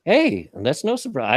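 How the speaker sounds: usual speaking voice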